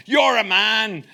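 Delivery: high, loud Devon sailor's cry; cod Devon accent. Retold or imitated. Coxswain praising Silver, loud cod Devon accent. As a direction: call out like an excited pirate in mock West Country. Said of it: "read this in a sort of cod Devon accent".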